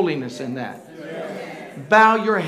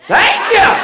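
high, loud cry of thank you; joyful shout; 12 semitones higher, 8 dB louder than usual. Retold or imitated. Imitating Welfa shouting in the middle of church, high and loud. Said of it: "she would just shout".

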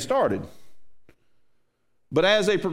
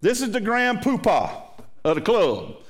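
normal talking voice

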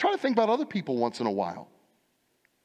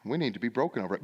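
usual speaking voice

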